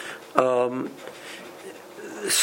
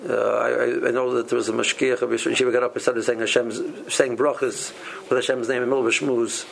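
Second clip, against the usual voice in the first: ordinary delivery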